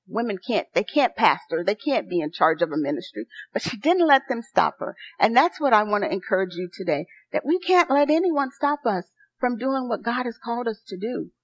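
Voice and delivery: ordinary talk, nothing marked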